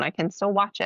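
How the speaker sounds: usual speaking voice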